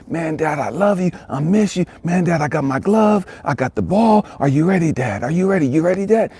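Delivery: put-on high, excited child's voice; eager little boy. Little boy begging his dad to play catch, high and eager.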